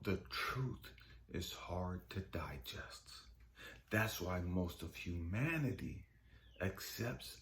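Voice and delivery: low, hushed voice, slowing on key phrases; soft, deep pronouncement; God. Retold or imitated. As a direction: deliver a quiet truth with pauses between phrases. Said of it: "He said".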